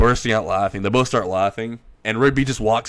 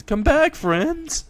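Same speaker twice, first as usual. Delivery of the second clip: put-on much higher, childlike cry after the butterflies; high calling Pops; much slower than usual.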